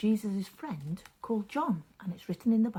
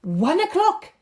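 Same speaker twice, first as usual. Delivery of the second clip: higher, louder firm confirmation; servants confirm; 8 semitones higher, 12 dB louder than usual. Acted. Servants confirming the hour to the father, sure. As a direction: confirm it simply. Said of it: "the men replied".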